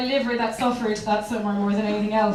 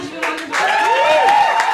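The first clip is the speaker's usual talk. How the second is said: showy sign-off; 12 semitones higher, 4 dB louder, much slower than usual. Real, not acted. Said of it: "stage sign-off".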